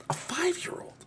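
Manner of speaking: hushed whisper; breathy aside. Real, not acted. Aside repeating for emphasis.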